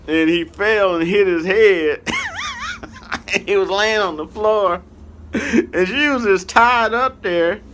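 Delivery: high, loud telling through laughter; cracking up. Real, not acted. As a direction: barely get the story out for laughing. Cracking up at his own story.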